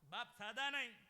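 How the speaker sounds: loud, high sermon cry; full-force preaching. Acted. Kirtankar telling the birth story, loud preaching. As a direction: preach it out to the back row.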